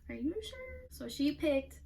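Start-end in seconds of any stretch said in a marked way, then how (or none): 0.1-0.9 much higher, disbelieving question; incredulous. Real, not acted. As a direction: ask it in shocked disbelief. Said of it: "same question asked again".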